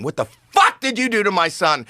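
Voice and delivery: high-pitched retold scream, held short of full volume; imitated screaming. Comic demands to know what happened to his son, imitated screaming. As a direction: act out his outrage, pitch leaping. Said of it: "he's, like, screaming".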